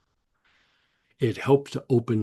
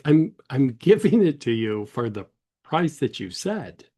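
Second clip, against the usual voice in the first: higher, louder imitation of a baffled dealer; mock exasperated car salesman; baffled insistence; 4 semitones higher, 5 dB louder than usual. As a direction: insist through a laugh, as if it's obvious. Baffled dealer repeats the refused offer.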